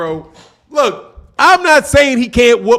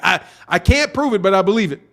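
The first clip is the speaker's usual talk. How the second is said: ordinary talk, nothing marked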